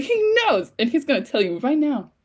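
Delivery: very high-pitched insistence, then dropping; exasperated outburst. Retold or imitated. Retells outburst at the anime.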